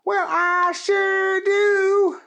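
very high, slow drawn-out drawl; Keith voice. Acted. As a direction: stretch every word like a lazy cowboy.

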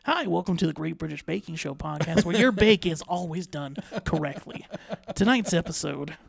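hammed-up TV host intro; show host intro. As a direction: open a TV baking show like a host. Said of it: "I feel like we're doing a bake, like".

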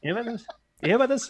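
high-pitched late-night host catchphrase; Jay Leno. Acted. Podcaster trying out a talk-show host's catchphrase, high impression. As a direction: pitch it up like a comic teasing a joke. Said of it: "do the Jay Leno voice".

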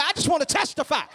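loud, high mock church testimony; testifying churchgoer. Churchgoer bragging to the church about a raise. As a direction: ham up a thrilled member testifying. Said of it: "you come to church and say".

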